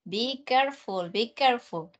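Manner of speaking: usual speaking voice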